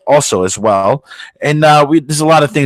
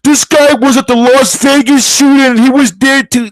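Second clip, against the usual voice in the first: high, eager mock of a conspiracy nut; mock conspiracy guy; 10 semitones higher than usual. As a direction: play the know-it-all truther, excited. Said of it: "he's like".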